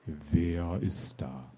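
slow eerie whisper from behind the gate; dark mysterious voice. Acted. Hidden voice behind the gate answers the knocking captain, slow whisper. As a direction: breathe the question slowly, unseen and menacing. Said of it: "ertönte eine dunkle Stimme".

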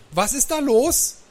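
high, loud demand; sharp question over the bus microphone; demanding granny. A narrator's reading. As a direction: demand to know, loud and way up in pitch. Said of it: "fragte übers Mikrofon".

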